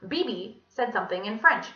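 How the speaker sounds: usual speaking voice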